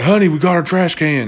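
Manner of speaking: husband phoning his wife at work, thrilled; giddy excitement. Retold or imitated. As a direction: brag about the trash can like it's a trophy. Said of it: "I was like".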